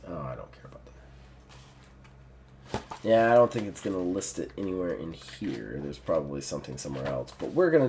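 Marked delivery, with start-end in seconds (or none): 0.1-2.8 whispered aside. Real, not acted. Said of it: "muttered aside".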